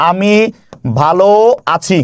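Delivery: আমি, ভালো, আছি with a pause after each; slow dictation of আমি ভালো আছি; word by word. Shown as a demonstration. Dictating a text message word by word. (আমি ভালো আছি থেমে থেমে বলা)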